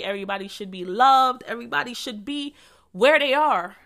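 usual speaking voice

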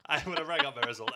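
fast laughing words. Real, not acted. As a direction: blurt it out between laughs. Laughing while admitting annoyance.

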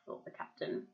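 plain reading voice

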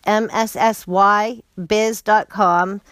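slow, letter by letter spelling; spelling it out slowly. Shown as a demonstration. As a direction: spell out the web address slowly. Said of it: "please check out Missy Biz".

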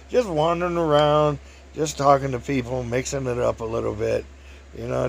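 usual speaking voice

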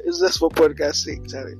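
usual speaking voice

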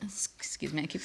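hushed, breathless excuse me; whispered apology. Real, not acted. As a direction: whisper it between coughs. Apologizing while coughing.

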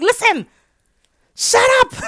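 high, loud snapping shout; mock angry kid. Child mock-yells at grandma to stop encouraging. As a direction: snap back like a fed-up kid.

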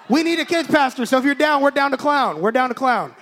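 loud, high, incredulous imitation; hammed-up job offer. Boss offering her a job on speakerphone, hammed-up. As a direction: belt out the goofy offer. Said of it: "This is how he offers her a job".

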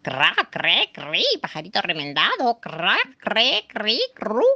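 mocking bird call, half sung; magpie's squawk. A narrator's reading. Magpie taunting the patched bird, mock squawk. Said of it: "la urraca le gritó".

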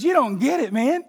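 high, loud, insistent retelling; excited testimony. Retold or imitated. Preacher quoting a believer to the congregation, excited. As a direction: retell it with fired-up conviction.

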